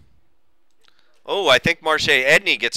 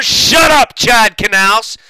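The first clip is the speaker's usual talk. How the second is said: higher jolt on the first words; irritated outburst; 5 semitones higher, 6 dB louder, much slower than usual. Real, not acted. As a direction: cut off the interrupter in annoyance. Snaps at voice interrupting his call.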